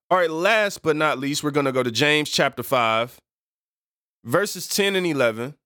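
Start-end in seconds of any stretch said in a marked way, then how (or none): none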